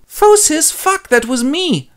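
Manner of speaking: very high, loud gasp then slow dread; the penny drops; shocked realisation. Acted. Oedipus realising he killed Laios, very high then slow.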